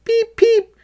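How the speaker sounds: very high, loud peeping cry; loud chick peep. A narrator's reading. Little Red warning the mouse, loud high peep. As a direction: peep as loud as you can. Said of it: "cried Little Red, as loud as he could".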